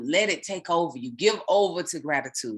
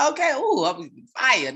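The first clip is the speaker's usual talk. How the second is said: real burst of excitement, higher pitch; fired up; 7 semitones higher, 4 dB louder than usual. Real, not acted. Getting worked up mid-reading, then excited yelp. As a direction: let the joy spill out. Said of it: "Let me calm down".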